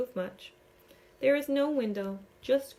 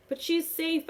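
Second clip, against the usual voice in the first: pleading; 6 semitones higher, 4 dB louder than usual. A narrator's reading. Defending herself in panic.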